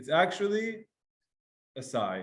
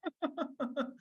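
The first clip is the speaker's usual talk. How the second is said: high, soft whimper; demonstrated whimpering sob; whimpering demo; 10 semitones higher, 11 dB quieter than usual. Shown as a demonstration. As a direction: whimper like a mother near tears. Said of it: "she's whimpering, like".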